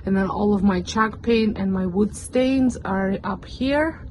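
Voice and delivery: ordinary talk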